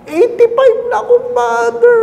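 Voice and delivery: a grandmother boasting her age; high elderly announcement; proud old lady. Retold or imitated.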